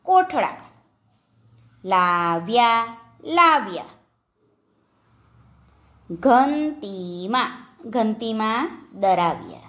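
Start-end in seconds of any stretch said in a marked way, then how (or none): 1.9-3.0 લાવ્યા low and drawn out; slow word demonstration. Shown as a demonstration. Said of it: "જોડણી પર ધ્યાન આપજો". 6.1-7.7 ઘંટીમાં slowly, syllable by syllable; slow word demonstration. Shown as a demonstration. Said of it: "જોડણી પર ધ્યાન આપજો".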